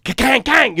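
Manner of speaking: high, punchy metallic clanging noise; imitated loud clang. Describing a curb jump to friends, loud imitated clanking. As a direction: mimic wheels banging over a curb. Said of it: "you know, that loud noise".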